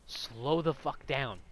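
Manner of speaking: drawn-out, emphatic order; slow exasperated demand. Retold or imitated. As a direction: stretch out each word for emphasis.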